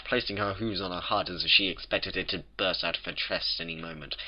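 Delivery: plain reading voice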